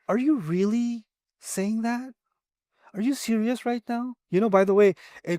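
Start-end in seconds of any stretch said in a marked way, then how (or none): 0.1-4.1 quiet, slow, incredulous jab; mock insulting question. A speaker mocking someone with a slow, quiet question. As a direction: sneer it softly, as if insulting. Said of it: "questions can be a really good way of insulting someone".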